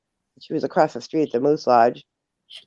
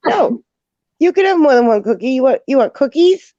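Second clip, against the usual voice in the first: put-on very high, louder baby talk; sing-song caregiver; 9 semitones higher, 5 dB louder than usual. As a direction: coax her, pitched way up. Coaxing elderly patient.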